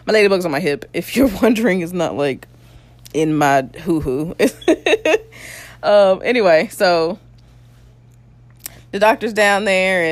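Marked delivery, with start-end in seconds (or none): 4.4-5.2 high squeaky giggle. Real, not acted. Laugh after joke.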